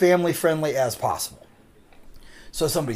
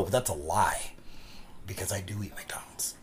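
confessional aside; 7 semitones lower, 12 dB quieter than usual. Retold or imitated. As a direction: trail down into a whispered confession. Confessing a lie under his breath.

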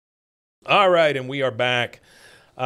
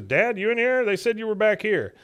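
lightly acted, higher-pitched call to dad; imitated son calling; 9 semitones higher than usual. Son looking for his dad in the bathroom, imitated calling. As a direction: raise the pitch as if checking through a doorway.

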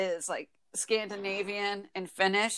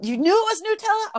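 jumps way up and louder in disbelief; shocked you knew that; high incredulous question; surprised disbelief; 12 semitones higher, 6 dB louder, faster than usual. Real, not acted. Surprised at friend.

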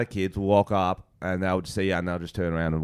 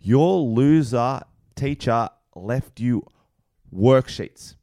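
slow, higher-pitched substitute teacher; put-on classroom voice, drawn out; teacher addressing class; 4 semitones higher, 4 dB louder, slower than usual. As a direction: lay out the deal to a class, slowly and deliberately. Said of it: "give me a class and I'm gonna get the best lesson possible".

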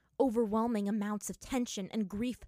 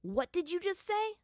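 higher, louder threatening question; dangerous challenge; 11 semitones higher, 3 dB louder than usual. Acted. Aang challenges Zuko, a hint of threat. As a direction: dare him to repeat it. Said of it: "narrowed his eyes dangerously".

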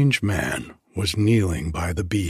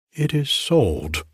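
small quaver; 6 semitones higher than usual. Acted. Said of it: "with a small quaver in her voice".

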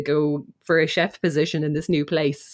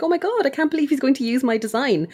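higher-pitched mock excitement; retold excitement; 7 semitones higher than usual. Retelling a designer's delight at her design being used, excited. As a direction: gush lightly, imitating someone thrilled. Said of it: "So she's all excited".

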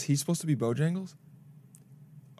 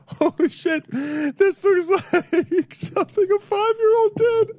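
high, slow wheezing laughter; cry-laughing through the words; laughing hysterically; 4 dB louder, much slower than usual. Real, not acted.

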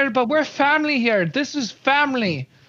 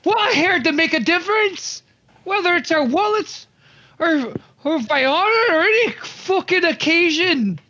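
high, rallying oration; rousing speech; 5 semitones higher than usual. Acted. New recruit rallying fellow recruits, rousing speech. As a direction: rouse the whole hall.

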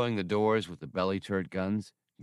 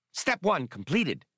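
high, quick alien chatter; brisk and chirpy, a touch higher; quick Skinks voice; 8 semitones higher than usual. A narrator's reading. Skinks to the crew, quick and high. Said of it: "he said in his quick fashion".

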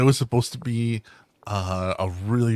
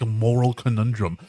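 usual speaking voice

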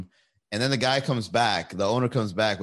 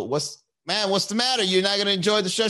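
mock challenge to heckler; 9 semitones higher than usual. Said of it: "I look at this lady and I go".

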